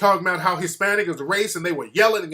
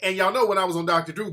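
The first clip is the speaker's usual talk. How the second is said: ordinary talk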